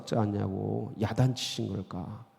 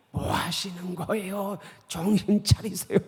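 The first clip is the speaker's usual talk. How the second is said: pitched up, half-mock scolding; exasperated protest; 8 semitones higher than usual. Peter grabbing Jesus, a touch of mock scolding. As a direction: pull Jesus up short like a friend who thinks he's lost it. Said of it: "이러는 거예요".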